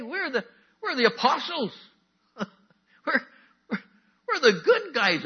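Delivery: high loud hammed-up apostle protesting; mock outraged disbelief, laughing through it; indignant disbelief. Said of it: "I would go".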